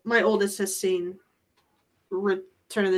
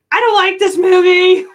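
high, loud mock wail; crying child; 10 semitones higher, 13 dB louder than usual. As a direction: wail like an upset little kid. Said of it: "Eli's crying and on Gabby going".

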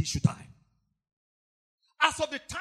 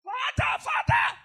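much higher, rising cry; shouting Father; 14 semitones higher, 4 dB louder than usual. Retold or imitated. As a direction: call out to the Father in anguish. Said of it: "Jesus was shouting".

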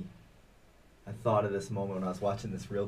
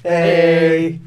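loud cheerful hey; hearty greeting; 8 semitones higher, 18 dB louder than usual. Real, not acted. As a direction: shout a big hey. Cohost shouts into the welcome.

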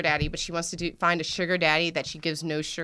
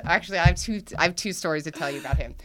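ordinary delivery

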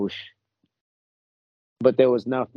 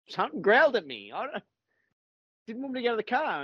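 high, swooping retold protest; alarmed insistence; 11 semitones higher, faster than usual. Child explains to doubting adults, alarmed insistence. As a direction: imitate a kid pleading to be believed.

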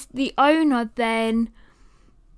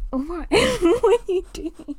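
high-pitched, amused laughing at the dog; 7 semitones higher than usual. Real, not acted. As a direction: giggle helplessly through the question. Amused at her dog's antics.

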